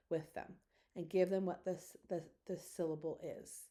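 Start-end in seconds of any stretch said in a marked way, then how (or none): none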